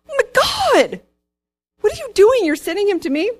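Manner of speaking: high-pitched mock panic; hammed-up freak-out, voice shooting up. King reacting to a letter sending him a leper, freaking out. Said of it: "the king's freaking out".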